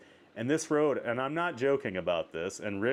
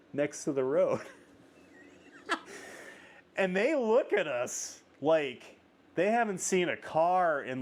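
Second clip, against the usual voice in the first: usual speaking voice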